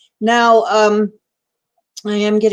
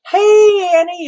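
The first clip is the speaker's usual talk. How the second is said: excited greeting; 11 semitones higher, 6 dB louder than usual. Real, not acted. Greeting a newcomer in chat.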